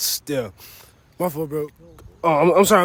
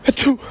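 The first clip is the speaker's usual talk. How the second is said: fake sneeze; 13 semitones higher than usual. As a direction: sneeze right at her. Prank sneeze on Granny.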